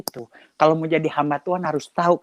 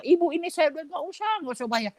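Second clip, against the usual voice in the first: high vendor voice; 10 semitones higher, 4 dB quieter, faster than usual. Retold or imitated.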